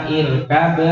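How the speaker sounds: "irkab" ending in a bouncing, released b; qalqalah on b. Shown as a demonstration. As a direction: stretch "irkab" and pop the final b. ("irkab" dengan ba dipantulkan)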